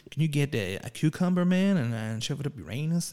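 lightly hammed American fan; bad American accent. Fan writing in with a request, in a bad American accent. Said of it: "really bad American accents".

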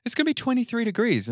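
very high mocking voice; prissy high-pitched mock worry; mimicked Sydney locals. Mimicking Sydney people fretting about warmth.

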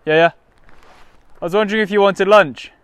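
loud, high-pitched call; calling from far away. Real, not acted. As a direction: call the question across a distance. Calling to distant friend.

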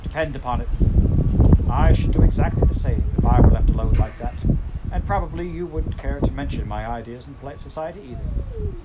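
the lady of the house speaks her mind. Acted. Mrs Seisman voiced loudly.

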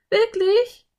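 put-on very high, loud girlish question; surprised girl. A narrator's reading. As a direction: squeal the question in happy disbelief. Anne asks in delighted surprise.

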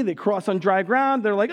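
usual speaking voice

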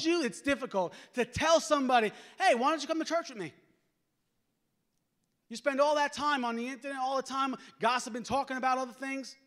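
high, loud, driving sermon delivery; impassioned preacher at full volume; full-force preaching. Real, not acted.

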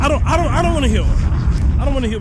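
loud fed-up outburst; loud exclamation. Real, not acted. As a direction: shout it in disbelief. Hyped reaction to clip.

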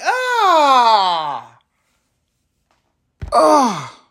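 long, high, falling cry of frustration; exasperated wail. Acted. Driver fed up with speed bumps, wailing in exasperation.

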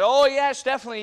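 high, full-volume shouted agreement; emphatic acted yell; yelled back. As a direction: yell your answer back across the church. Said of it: "He yelled back and said".

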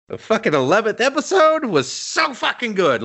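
high, gushing rave; bursting with fan excitement. Real, not acted. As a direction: rave about it like you can't contain yourself. Gushing about favorite episode.

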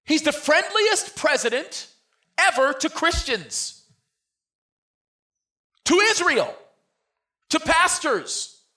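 drawn-out high preacher's cry; slow fiery preaching. Acted. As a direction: stretch each phrase out for the crowd.

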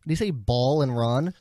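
ordinary talk, nothing marked

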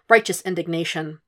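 usual speaking voice

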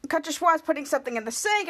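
ordinary delivery

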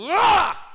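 high loud anguished yell; frustrated yell. Acted. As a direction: let out a cry of frustration. Cry of frustration.

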